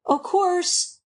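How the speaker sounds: halting, mildly reluctant agreement. A narrator's reading. Jephson grudgingly answers Alice's question, reluctant. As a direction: concede it bit by bit, with pauses. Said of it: "with reluctance".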